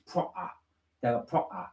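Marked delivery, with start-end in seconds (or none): 0.1-0.5 Essex accent. Shown as a demonstration. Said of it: "So, like, from Essex". 1.3-1.7 Essex accent. Shown as a demonstration. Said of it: "like that".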